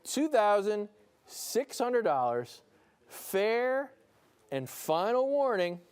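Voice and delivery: slow, high "fair and final warning". Real, not acted. Auctioneer giving the last warning to bidders. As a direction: drag out the final call. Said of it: "I'll say it slowly".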